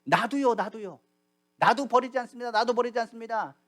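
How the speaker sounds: high, loud, eager pledges; acted clamour of disciples; shouting over each other. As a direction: call out as if competing to be heard. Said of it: "서로 소리 지릅니다".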